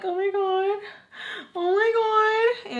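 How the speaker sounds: high, drawn-out giddy gasping; inner squeal. Retold or imitated. Flustered inside after he calls her wifey, giddy squeal. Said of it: "I can't help but get like".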